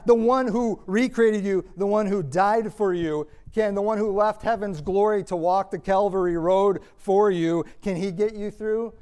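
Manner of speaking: ordinary talk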